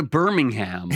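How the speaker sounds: "Birmingham" with a full HAM ending; American-style "Birmingham"; H pronounced. Acted. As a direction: say "Birmingham" as Americans would, sounding the H. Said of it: "Birmingham with the H".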